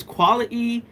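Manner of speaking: "quality" with the t dropped, British style; British pronunciation. Shown as a demonstration. Streamer asking UK viewers about their words, British-style "quality". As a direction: say "quality" with a glottal stop before the last vowel. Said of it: "pronounce like that".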